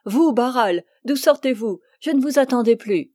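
loud, quick glad cry of surprise; a hostess surprised by her late visitor, exclaiming. A narrator's reading. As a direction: call out to him, delighted and fast. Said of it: "Fanny s'écria".

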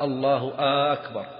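أكبر begun with long "aaakbar"; slow, hold the first a of أكبر; stretched-vowel demo. Shown as a demonstration. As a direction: prolong the opening vowel of أكبر. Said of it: "أيضًا استفهامية".

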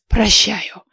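lightly voiced grudging elder; grunting old man. A narrator's reading. Old oracle forgiving the girl, mildly grudging. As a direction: grant it with a mild huff. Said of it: "хмыкнул голос".

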